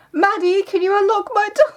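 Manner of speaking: high muffled cry for help; sister calling through the door. Retold or imitated. Sister stuck in her room, calling for help, high and muffled. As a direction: call out like someone shut behind a door. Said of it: "and I hear".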